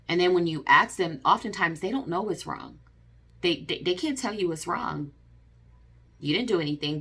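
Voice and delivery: ordinary talk, nothing marked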